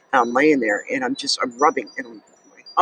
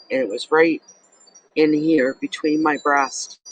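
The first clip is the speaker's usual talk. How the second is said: ordinary talk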